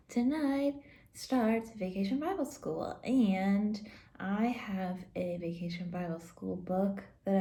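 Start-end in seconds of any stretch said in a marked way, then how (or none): none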